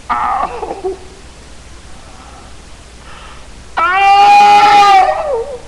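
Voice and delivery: long, high, loud howl of pain; screams of pain. Acted. Son burning in hell, screaming in pain. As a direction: cry out as if burning. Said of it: "from out of the midst of the screams".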